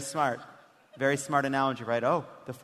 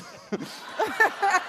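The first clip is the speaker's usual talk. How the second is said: high laugh. Real, not acted. Host laughs hard.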